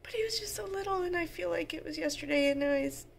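fighting back real tears; getting emotional. Real, not acted. Mom after watching her toddler's old video, choked up. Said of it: "Don't mind me. This is what I do".

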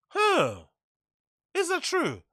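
high-pitched, questioning surprise; puzzled surprise. Real, not acted. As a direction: react with doubt, voice shooting up high. Puzzled by what he just read.

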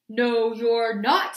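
loud, slow troll refusal. A narrator's reading. Troll refusing the second goat, loud and slow. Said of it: "said the troll".

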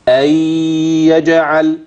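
أن يجعل with the n turned into a long nasal doubled y; merging with ghunna. Shown as a demonstration. Teacher demonstrates joining to the next word, nasal merged chant. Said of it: "هحول النون دي لياء وهدخلهم الاتنين".

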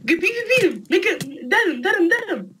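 high urgent cheering; yelling from the sidelines. Real, not acted. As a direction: egg him on, high and urgent. Cheering teammate in fight.

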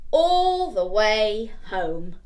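slow, loud drawn-out ending. A narrator's reading. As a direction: stretch every word to finish the scene.

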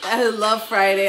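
ordinary talk

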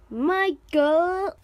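loud accusing call. Pretend. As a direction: call his name across the room. Calling out the villain.

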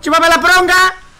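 loud, high taunting shout; defiant yell. Real, not acted. Streamer taunting the enemy chasing him, loud. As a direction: yell it at the chaser. Yelling at the game chaser.

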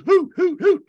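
high, loud owl hoots; high-pitched "hoo" calls; owl hoot imitation. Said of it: "he kinda goes".

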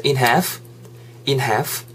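"half" said as "haf" with no L sound; silent L in half. Shown as a demonstration. Teacher showing that the L in "half" is silent. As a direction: drop the L in "half" and just say "haf". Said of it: "L이 묵음이죠".

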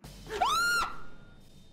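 very high-pitched gasping scream; sudden high yelp of fright; startled shriek. Real, not acted. Streamer jump-scared by the game, shrieking.

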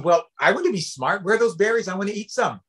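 high, keen story character; eager Badger. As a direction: pitch it up, hungry to get some. Badger eagerly wants the berries.

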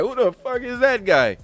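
high, loud baffled Irish rant; perplexed Conor. Retold or imitated. Quoting Conor to a friend, high loud baffled rant. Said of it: "truly perplexed".

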